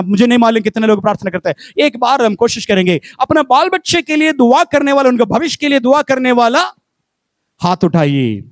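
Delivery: ordinary talk, nothing marked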